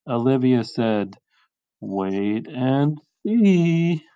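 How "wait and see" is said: very slow, drawn-out promise; teasing assurance. Acted. Olivia answering her doubting father, slow and knowing. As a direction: stretch out the last word knowingly.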